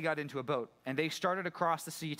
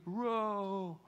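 higher, effortful rowing calls; a hint of weary disciples hauling oars; straining rower; 7 semitones higher than usual. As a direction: pull each word like a stroke of the oars. Acting out rowing in the storm.

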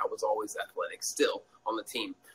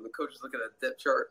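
usual speaking voice

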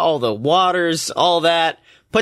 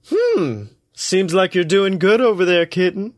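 smug teasing voice; 4 semitones higher than usual. Acted. God teasing his drinking rival.

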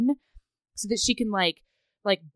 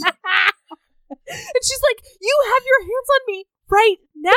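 real laughter breaking the quote; laughing through a quote; 14 semitones higher than usual. Real, not acted. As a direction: force the words out through giggles. Acted quote while laughing.

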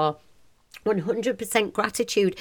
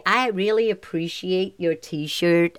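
slow, hammed-up American accent; attempted American accent; 2 semitones lower, slower than usual. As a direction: put on an American accent, stretching it out. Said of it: "is that a good American accent?".

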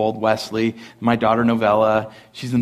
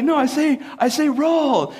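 high-pitched insistent imitation of wife; hammed-up protest, pitched well up; 13 semitones higher than usual. Wife defending her word, high protesting imitation. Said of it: "she's like".

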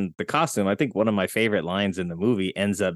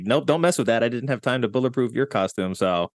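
quick mock warning, slightly raised voice; 3 semitones higher than usual. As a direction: imitate a hero cautioning his sidekick mid-action. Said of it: "He's just, like, warning him".

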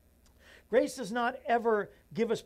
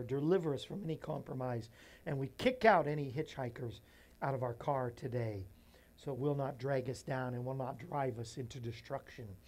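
quiet earnest prayer; 4 semitones lower, 3 dB quieter than usual. Acted. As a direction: pray it softly and sincerely.

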